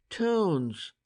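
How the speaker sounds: sudden high exclamation of discovery; exclaiming. A narrator's reading. Price realising the circles mark towns, exclaiming. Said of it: "Price exclaimed".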